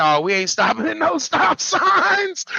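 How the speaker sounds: high-pitched comic panic, stretching the last words; retold panic, voice shooting way up; alarmed refusal. Acted reaction at sight of a gun.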